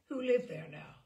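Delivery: plain reading voice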